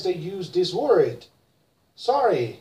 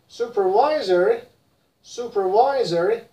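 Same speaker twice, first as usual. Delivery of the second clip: American supervisory, louder, rising; American accent; 3 dB louder than usual. Shown as a demonstration. Teacher models American supervisory: American accent. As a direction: say supervisory the American way, all syllables. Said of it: "in American".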